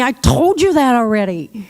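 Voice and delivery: mildly irritated retort; a touch of impatience, lightly voiced. Shown as a demonstration. Caregiver to repeating person, mildly irritated. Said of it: "tempting when we're irritated".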